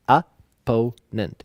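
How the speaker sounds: opponent drawn out slowly, o-PO-nent; slow teaching "opponent" with second-syllable stress; syllable-by-syllable demo. Shown as a demonstration. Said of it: "You stress the second one".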